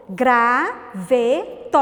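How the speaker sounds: GRAVETO very slow, "gra... ve... to"; syllable by syllable. Shown as a demonstration. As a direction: pause after each syllable of Graveto. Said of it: "Quantas sílabas?". (Graveto separado em três sílabas, bem devagar)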